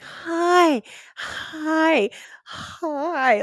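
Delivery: high, sweet bedside voice; warm gooey patient voice. Retold or imitated. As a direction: coo it like a doctor soothing a patient. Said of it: "warm, gooey patient voice".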